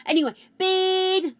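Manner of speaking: high, loud burst; excited. Real, not acted. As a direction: bean cry. Excited shout about beans.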